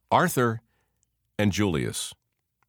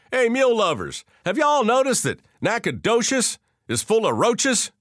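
heckling; 13 semitones higher, 4 dB louder than usual. Acted. Comedian heckling the crowd.

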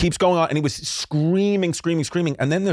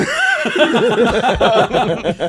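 big laugh; 9 semitones higher, 5 dB louder than usual. Real, not acted. Laughing at his own code.

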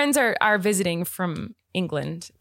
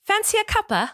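high-pitched put-on British accent; playful high British accent; British accent attempt; 13 semitones higher than usual. Shown as a demonstration. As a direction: have a go at a British tea offer. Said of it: "give our best shot of doing it".

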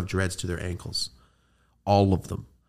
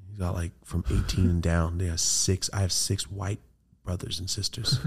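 ordinary talk, nothing marked

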